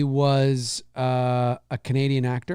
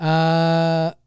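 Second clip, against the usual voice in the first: ordinary talk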